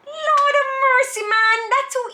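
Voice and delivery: high-pitched Lord of mercy exasperation; exasperated exclamation. Real, not acted. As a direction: cry out at how easy it is. Question judged too easy.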